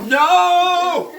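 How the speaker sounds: loud protest. Real, not acted. Refusing food being pushed on him.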